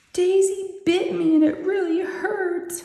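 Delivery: slow and high, a mock tearful whimper; imitating crying child. Mom retelling her daughter's teary complaint, a slow, high mock whimper. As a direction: echo a hurt kid's teary complaint. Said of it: "she's in tears and she's crying".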